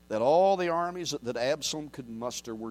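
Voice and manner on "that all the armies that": usual speaking voice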